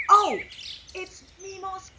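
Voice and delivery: high, loud surprised oh; sudden realization. A narrator's reading. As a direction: gasp it out as if you just remembered. Sonny suddenly remembers.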